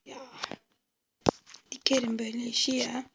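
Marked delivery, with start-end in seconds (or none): 1.7-3.0 whispering. Real, not acted. Unvoiced muttered aside.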